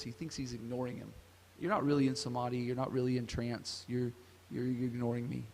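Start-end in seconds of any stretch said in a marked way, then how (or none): 1.6-5.4 quick, hushed accusation; a hint of a suspicious king muttering fast; accusing king. King to the meditating sage, lightly voiced suspicion.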